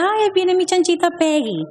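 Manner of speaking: high, sing-song imitation of mom; mother's pet name. Imitating her mother greeting her by a pet name, high and cooing. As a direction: mimic mom's cooing teasing voice. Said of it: "Mi mamá de cariño me decía".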